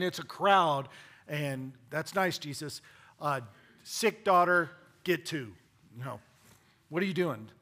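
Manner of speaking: hurried, exasperated crowd-pusher; impatient disciples. Retold or imitated. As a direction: push him along, fed up. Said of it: "And the disciples like".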